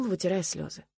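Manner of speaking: usual speaking voice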